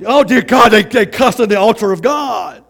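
mock-scandalized gasp, loud and slow; pearl-clutching outrage, hammed up; shocked churchgoer. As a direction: play the horrified church lady. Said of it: "I'm not gonna be".

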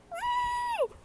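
very high, soft woo. Real, not acted. Winding down from rant about sister, high soft woo. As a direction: let out a tiny falsetto woo.

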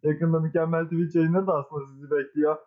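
high-pitched mock-grand host intro; show intro announcer. Host welcomes listeners to a new episode, high announcer voice.

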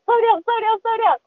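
repeated high excited warning; excited urging. Retold or imitated. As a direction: urge your friend to ease off the reel. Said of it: "she's like".

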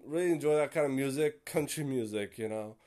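ordinary delivery

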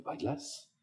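whispered, barely voiced ask; shy whisper. Shy fifteen-year-old asks a girl to dance, whispering. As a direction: ask her timidly in a whisper.

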